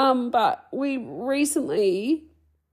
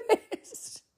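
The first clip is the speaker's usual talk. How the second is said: high, slow tearful laugh; 10 semitones higher than usual. Real, not acted. Host admitting she is still working through things, tearful. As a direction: crack up between crying and laughing. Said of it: "I'm just sad".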